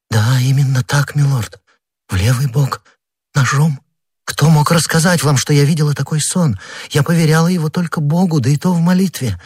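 high, breathless amazement; a queen stunned her dream is known; astonished Queen Anne. A narrator's reading. (изумлённая королева, высоким голосом)

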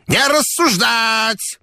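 put-on high, rattling old man's shout; quavering old man. A narrator's reading. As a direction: quaver it like a doddering old boss. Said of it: "дребезжал".

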